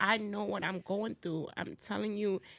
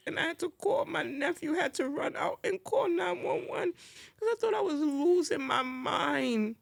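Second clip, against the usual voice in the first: the memory catching in her throat; tearful; 6 semitones higher than usual. Real, not acted. Telling hosts about her anxiety attack, near tears.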